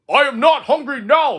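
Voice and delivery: high, loud gloating ghost voice; the dark frog taunting little Frog, loud and gloating; menacing dark frog. Acted. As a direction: gloat over your victim. Ghost frog threatens child.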